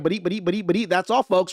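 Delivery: cartoonish stutter on repeated syllables; stuttering Stan. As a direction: stammer it like a cartoon sign-off. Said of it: "they sound like stuttering Stan".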